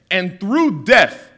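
"through death" drawn out slowly; weighty slowed phrase; slow emphasis. Acted.

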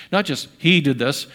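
normal talking voice

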